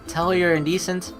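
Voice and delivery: usual speaking voice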